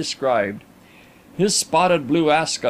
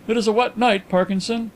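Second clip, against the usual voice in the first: higher, lightly acted generous remark; 6 semitones higher than usual. A narrator's reading. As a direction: excuse it generously to a servant. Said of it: "interposed Mister Carlyle generously".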